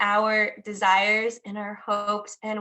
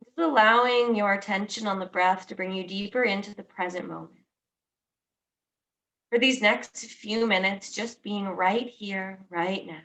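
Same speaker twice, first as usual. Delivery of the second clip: guided meditation. Acted. Teacher guiding listeners into noticing their breath, calm guided meditation. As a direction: ease each phrase out and let it settle.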